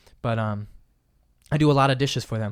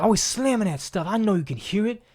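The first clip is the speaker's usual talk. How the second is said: higher, louder scolding; annoyed dishwasher; 8 semitones higher, 3 dB louder than usual. Retold or imitated. Dishwasher complaining about slammed dishes, annoyed. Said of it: "the dishwasher yelled at me".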